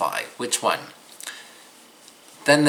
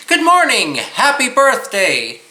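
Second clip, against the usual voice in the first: high, loud, bouncing singsong greeting; lively sing-song greeting; 11 semitones higher, 5 dB louder than usual. Shown as a demonstration. Teacher demoing the tune to copy, high and lilting. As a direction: greet them with a bright, lilting lift. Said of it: "imitate the intonation and inflection".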